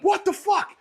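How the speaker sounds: high-pitched disbelieving yelp; retold shock. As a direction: squeak it out in sudden disbelief. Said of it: "I'm like".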